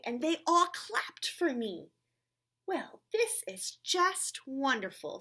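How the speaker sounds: lively read-aloud with character voices; reading to children. Acted. Reading a picture book aloud to children, animated. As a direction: read it brightly to the little ones.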